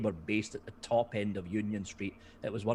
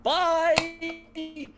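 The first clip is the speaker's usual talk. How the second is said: mock cheery goodbye; 7 dB louder than usual. CEO abandoning sinking company.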